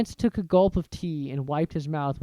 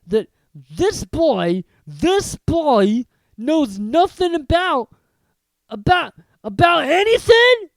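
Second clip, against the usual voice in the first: slow loud growl rising to a roar; outrage building to a bellow; 11 semitones higher, 12 dB louder, slower than usual. Acted. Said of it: "he growled at the Dursleys".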